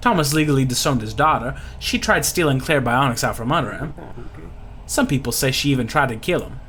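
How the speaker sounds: put-on Louisiana-accented local gossip; folksy Louisiana pirate. Lucky explaining Thomas to the party, folksy Louisiana voice. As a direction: fill them in like a bayou insider.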